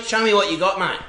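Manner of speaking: normal talking voice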